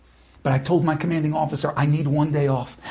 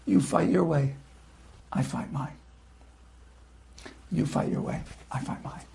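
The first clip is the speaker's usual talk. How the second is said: calm quiet reply; 2 semitones lower, 9 dB quieter than usual. Retold or imitated. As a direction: answer quietly and firmly. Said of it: "he just said back to me".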